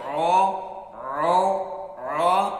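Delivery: او with rounded lips; wrong rounded vowel. Shown as a demonstration. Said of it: "آپ نے ہونٹوں کو گول نہیں کرنا".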